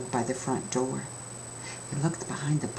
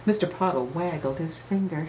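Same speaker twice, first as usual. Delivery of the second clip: ordinary delivery, nothing marked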